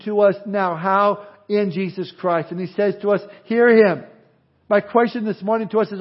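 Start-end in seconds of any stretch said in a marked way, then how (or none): 3.4-4.0 hear him, called out louder; loud command. Acted. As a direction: boom out the command to listen. Preacher quoting God's command.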